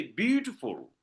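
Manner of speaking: "beautiful" with BEAU stressed, the rest falling away; stress on first syllable. Shown as a demonstration. Teacher giving a dactyl example, first syllable of "beautiful" stressed. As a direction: lean on the start of "beautiful". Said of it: "one stressed syllable".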